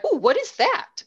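curious surprise. Retold or imitated. Student hears of a new career from advisor, surprised and curious. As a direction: jump up in pitch, newly intrigued.

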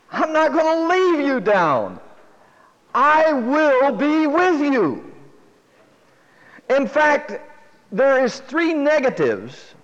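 preaching at full force. Acted. Preacher to his congregation, fervent preaching. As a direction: proclaim it to the congregation.

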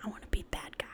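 hushed, whispered plea. Real, not acted. Co-host asking for the villain role, whispering. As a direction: whisper it as a playful little request.

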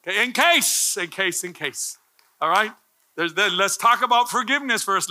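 loud, high preacher's cry; fiery preaching. Acted. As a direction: preach it out at full force. Preaching at full force.

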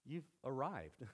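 ordinary delivery

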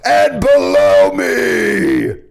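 put-on slow, loud, stretched-out drunk growl; drawn-out growl. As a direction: stretch every word out, loud. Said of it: "his girlfriend being like".

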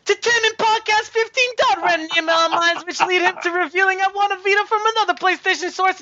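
squeaky send-up of the letter writer; high caricature of Alfonso reading his own email; imitating Alfonso. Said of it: "what it would have sounded like if Alfonso said it".

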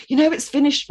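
high, loud, eager exclamation; excited old woman. Retold or imitated. Old woman on the bus telling her, excitedly. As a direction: tell her the news with excitement. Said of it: "She's getting all excited".

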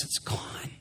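soft whisper of relief; awed whisper. Retold or imitated. Prisoner telling the preacher his burden is gone, whispered. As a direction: let it slip out in wonder.

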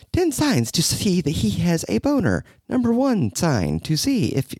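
high, measured text-to-speech read; GPS dictation voice. Acted. Host mocking how the reading sounded, GPS dictation voice. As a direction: imitate a robotic dictation readout. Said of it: "sounded like one of those, like, G P S, like, dictation".

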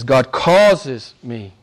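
"causes" stretched out and leaned on; low, very slow reading weighting "causes"; stress on causes. Shown as a demonstration. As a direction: draw out "causes" slowly for emphasis. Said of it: "The literal translation is".

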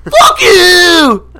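high, drawn-out mock scream; mock heel outburst. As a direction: yell it way up high, hamming it up. Playful heel outburst at Jimmy Hart.

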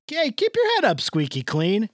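"Hey, keep your head up" jumping very high and bright, "squeaky clean" rising; cheerful sing-song "Hey, keep your head up, squeaky clean"; friendly cadence. Shown as a demonstration. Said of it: "it doesn't sound like a threat".

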